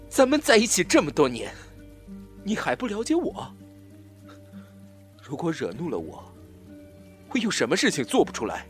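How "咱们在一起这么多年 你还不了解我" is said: high, worked-up challenge; menacing taunt. Acted. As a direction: taunt an old lover, voice raised. Captor taunts former lover. (激动地高声挑衅)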